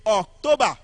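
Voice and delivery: "October" loud, with TO stressed; emphatic "October", weight on TO; second-syllable stress. Shown as a demonstration. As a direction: hit the middle of "October". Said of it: "As I pronounce them".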